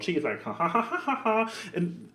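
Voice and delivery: ordinary talk, nothing marked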